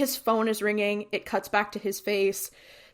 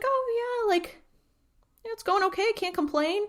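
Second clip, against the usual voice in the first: pitched up, breezy office-worker answer; mock casual Dean; 12 semitones higher than usual. Host voicing Dean brushing off his boss, pitched up and breezy. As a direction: play it easy and chipper.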